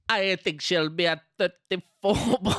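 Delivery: put-on playful Jamaican Rasta accent; rasta speech. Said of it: "I was doing the Rasta voice".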